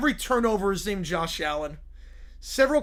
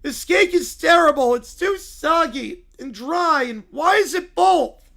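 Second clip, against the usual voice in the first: loud, higher, slow outraged complaint; angry complaint; 6 semitones higher, 8 dB louder than usual. Acted. Lumber Bear complains about the soggy dry cake, loud outrage. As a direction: belt out the disgust.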